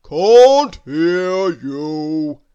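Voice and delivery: very slow, loud boom; butcher's big drawn-out voice. A narrator's reading. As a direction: boom it out, long on every word. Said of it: "boomed the butcher".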